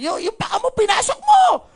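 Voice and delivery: high, loud scolding outburst; scolding shout. Acted. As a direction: yell it as an exasperated telling-off. Acts out an angry scolding.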